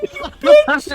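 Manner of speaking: high, loud mock insult; mock yell. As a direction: shout it in jest.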